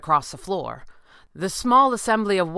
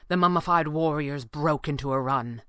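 ordinary delivery, nothing marked

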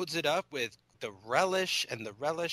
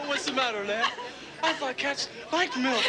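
laughing; 13 semitones higher than usual. Retold or imitated. Quoting the movie while laughing.

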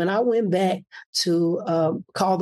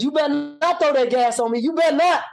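firm raised warning, rising toward the last words; retelling a stern warning with a raised voice; 7 semitones higher than usual. Retold or imitated. Warning the man at the gas pump, raised stern voice. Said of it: "I raised my tone".

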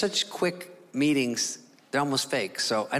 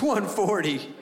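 retold doctor's higher, doubtful repeat; incredulous echo; 6 semitones higher, much slower than usual. Doctor doubting the patient's stated weight, incredulous. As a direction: repeat the figure back in imitated disbelief.

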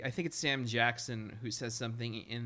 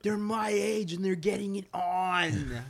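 mock teen awe; 6 semitones higher, 4 dB louder than usual. Teen self impressed by older kids.

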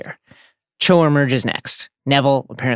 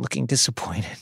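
ordinary delivery

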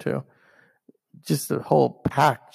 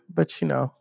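ordinary talk, nothing marked

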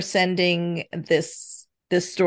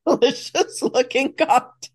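laughing mockery; 6 semitones higher than usual. Mocking daughter's phrase, laughing.

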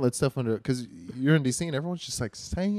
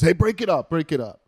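loud stern dad call, mock; hammed-up dad breaking up kids; mock dad yelling break it up; dad scolding; 6 semitones higher, 7 dB louder than usual. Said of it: "the dad goes".

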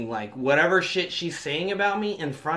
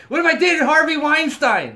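loud, high-pitched mock challenge; girlfriend screaming; 10 semitones higher, 6 dB louder than usual. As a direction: imitate a daughter shouting back at mom.

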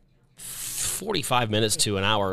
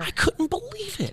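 amazed; 3 dB quieter than usual. Real, not acted. Still amazed.

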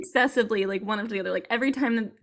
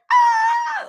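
sudden high-pitched fangirl shriek; excited squeal; 9 dB louder than usual. Retold or imitated. Reader reacting to a cute romance with a loud high shriek. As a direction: shriek with delight, much higher and louder. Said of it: "you're just like".

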